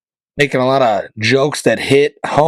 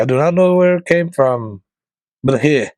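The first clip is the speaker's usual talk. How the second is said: light imitation of a Polish accent, a little higher; 3 semitones higher than usual. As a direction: mildly mimic the Polish seller's accent. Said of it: "She had, like, an accent".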